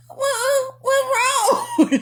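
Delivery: a squeaky, giggly what's wrong; high innocent whine. Retold or imitated. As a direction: whine like a clueless kid. Innocent reply, laughing.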